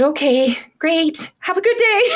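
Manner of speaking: high-pitched mock-cheery goodbye, breaking into laughter; retold breezy sign-off. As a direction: chirp it high, then laugh. Quick retold exit after confrontation.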